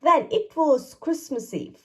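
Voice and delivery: ordinary delivery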